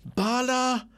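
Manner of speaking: high, slowed, indignant insistence; indignant wife. Acted.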